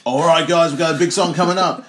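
loud, high hype DJ call; DJ announcer. Retold or imitated. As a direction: hype the crowd like a DJ. Mimicking DJ announcement.

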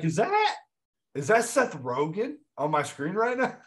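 high-pitched, incredulous double take; retold astonishment. Telling co-host about spotting Seth Rogen in Donnie Darko, retold astonishment. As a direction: act out disbelief, voice shooting way up.

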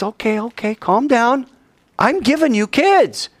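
high, calming voice; calm him down gently; soothing God. Acted. God soothing a worried Abraham, high and gentle.

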